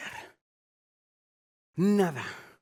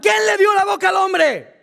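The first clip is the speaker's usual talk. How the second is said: God's voice, higher and much louder; a louder, higher voice of God answering the stammering excuse; booming rebuke; 9 semitones higher, 8 dB louder than usual. Acted. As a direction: thunder it back at the excuse.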